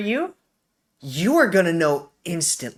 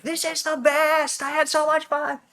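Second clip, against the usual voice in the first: very high, sustained mock cheer; imitating gleeful yelling; 13 semitones higher than usual. Sledder cheering at the bottom of the hill, high mock yell. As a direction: draw it out high, like a contained holler. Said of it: "I was yelling in English".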